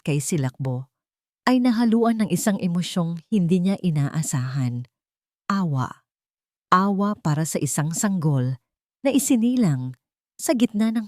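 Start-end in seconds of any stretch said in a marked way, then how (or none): none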